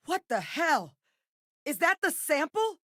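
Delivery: from high startled cry up to screamed orders; high-pitched, urgent yelling that peaks on the fire commands; alarmed combat shouting. Acted. Soldier spotting the creature and ordering fire, alarmed shouting.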